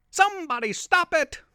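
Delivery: high frightened shriek; panicked scream. Acted. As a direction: cry out for help in terror.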